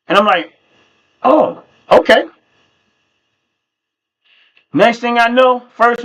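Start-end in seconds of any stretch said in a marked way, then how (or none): 1.2-2.3 high, drawn-out surprised okay. Retold or imitated. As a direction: caught off guard, slowly. Mom suddenly going to church.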